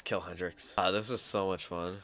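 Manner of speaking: ordinary delivery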